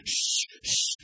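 sharp hushing. Retold or imitated. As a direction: shush him down. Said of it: "everybody's looking at him going".

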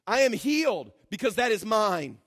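ordinary talk

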